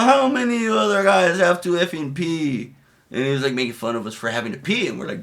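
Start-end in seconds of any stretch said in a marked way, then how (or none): 0.0-2.7 mocking sergeant. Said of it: "he's like".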